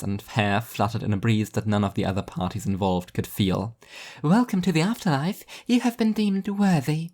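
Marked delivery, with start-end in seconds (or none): 4.2-7.1 high, bright angelic welcome; sweet angel voice. Acted. Angel welcoming Martin to the afterlife, high and sweet. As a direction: welcome him warmly from on high. Angel greets the new arrival.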